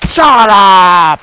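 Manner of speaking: loud mock yell; mock angry dad. A dad yells back at his son. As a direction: bark it like a cranky dad.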